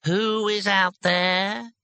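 slow, high, drawn-out character voice. A narrator's reading. Voice behind the door answers a shout, very slowly. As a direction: stretch every word out suspiciously slowly. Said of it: "very slowly".

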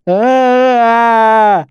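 high, loud drawn-out "aah"; long insistent bleat of assent; wordless answering cry. A narrator's reading. Loud high wordless answer.

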